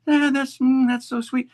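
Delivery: high, prim mock praise of a tame crowd; mock polite crowd. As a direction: imitate a tame audience sighing sweetly. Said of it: "they play and they go".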